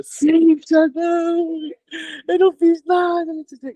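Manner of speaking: high, tearful pleading to the mentor; retold crying. Retelling to the mentor how she cried, in a high tearful voice. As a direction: whimper it out like a panicked student.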